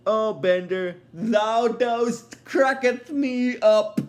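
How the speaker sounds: put-on slow, high old-timey American drawl; old-timey American accent. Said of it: "old-timey American accent".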